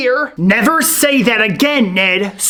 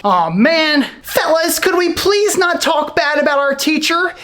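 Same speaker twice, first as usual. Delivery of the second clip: normal talking voice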